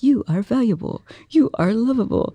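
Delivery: high, measured motivational recording voice; mock affirmation. Morning recording to listeners, mock-earnest affirmation. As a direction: parody a morning self-help track.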